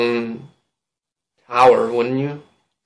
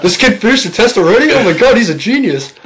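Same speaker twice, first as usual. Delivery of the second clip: mock awed classmates; 10 semitones higher, 6 dB louder than usual. Imitating classmates' amazement.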